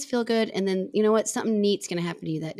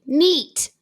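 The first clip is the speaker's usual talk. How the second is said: mad, curt "neat", pitched up; 7 semitones higher, 4 dB louder than usual. Shown as a demonstration. Showing co-host the mad version of neat, mad. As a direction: say it like an irritated verdict. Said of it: "when I'm mad and I say".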